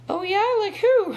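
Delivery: put-on high, loud little-kid squeal; eight-year-old voice. Child answering mom on the way to set, high eight-year-old voice. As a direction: pitch way up like an excited small child. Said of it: "in my eight-year-old voice".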